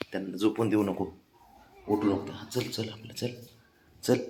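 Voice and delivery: soft hush, dropping into a whisper so nobody wakes; low murmur sinking to a whisper; hushed, then whispered. Real, not acted. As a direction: keep it down for the sleepers, then whisper. Don't wake the sleeping ones.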